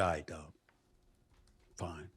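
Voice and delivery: quiet. Retold or imitated. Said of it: "I said".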